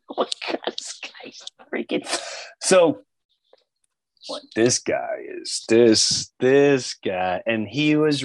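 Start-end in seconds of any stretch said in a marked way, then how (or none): 0.1-2.1 hushed, breathless aside; amazed whisper. Retold or imitated. About Norm to the host, whispered in disbelief. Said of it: "whispered aside".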